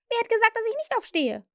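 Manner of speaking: high, loud indignant call; fairy crying out, jumping up. A narrator's reading. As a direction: fling the question back. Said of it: "rief sie".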